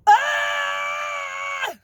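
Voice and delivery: sudden high, loud scream of frustration; frustrated scream. Real, not acted. Alone in the doctor's waiting room, releasing pent-up stress with a scream. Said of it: "I had to get that out".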